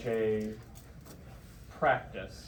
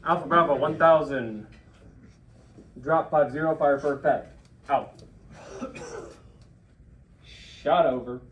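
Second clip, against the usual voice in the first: loud, measured radio readback; 2 semitones higher, 8 dB louder than usual. Shown as a demonstration. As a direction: read it back firmly over the net. Reads back the fire call.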